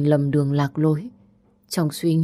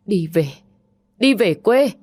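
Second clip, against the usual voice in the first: higher, louder final command; angry order; 4 semitones higher, 4 dB louder than usual. Acted. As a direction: slam the order home in anger. Mother orders daughter home. (mẹ ra lệnh cho con gái về quê, giọng quát to)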